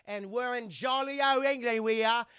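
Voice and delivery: mock British.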